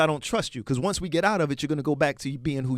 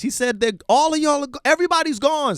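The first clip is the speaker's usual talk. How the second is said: heated shout; 9 semitones higher, 6 dB louder than usual. Real, not acted. Heated rant about deportations.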